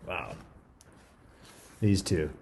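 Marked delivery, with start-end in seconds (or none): none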